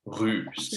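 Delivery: « Russe » dit avec un u français, lèvres arrondies, pas « ou »; russe avec le son u. Shown as a demonstration. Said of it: "it's U". (« Russe » prononcé clairement avec le u français)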